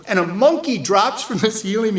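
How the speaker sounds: usual speaking voice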